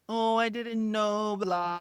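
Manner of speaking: slow, put-on "oh, I didn't know"; mock apology. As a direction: imitate a dismissive fake apology, stretched out. Mimicking girlfriend's lame apology.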